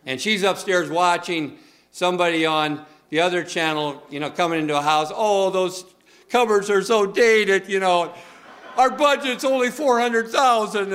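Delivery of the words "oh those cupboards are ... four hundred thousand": lightly put-on fussy buyer voice, a bit higher, laughing at the end; mock TV home buyer. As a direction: poke fun at the picky couple on the TV show.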